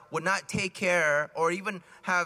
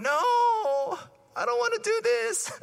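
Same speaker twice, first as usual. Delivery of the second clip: put-on high whining child's voice, much higher; whiny kid; slower than usual. Kid whining at the catechism teacher, high and pleading. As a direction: whine like a bored kid in class.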